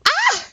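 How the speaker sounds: a sudden high excited "Ah!"; surprised squeal. Real, not acted. Fan spotting his idol's picture, high excited squeal. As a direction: squeal in delighted recognition. Recognizes Markiplier, his twin.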